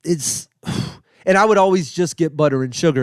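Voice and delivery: ordinary talk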